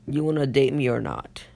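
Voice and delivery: usual speaking voice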